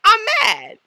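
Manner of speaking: high, loud angry declaration; mock indignant outburst. Voicing an underestimated rapper, mock indignation. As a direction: throw it out high and loud, playing mad.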